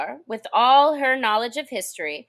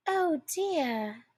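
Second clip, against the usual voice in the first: sorrowful tone; 6 semitones higher, 7 dB quieter than usual. A narrator's reading. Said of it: "cried Alice in a sorrowful tone".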